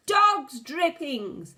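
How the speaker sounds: slow, high drawn-out yell; witches' yell. A narrator's reading. A boy imagines witches yelling, loud and high. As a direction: cry it out like a witch catching a child. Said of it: "the yell of".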